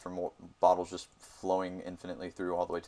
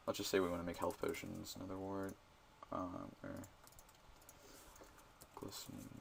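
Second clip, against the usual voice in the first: talking to myself; 2 semitones lower, 18 dB quieter than usual. Real, not acted. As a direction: mumble the list under your breath. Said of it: "Sorry, I'm talking to myself".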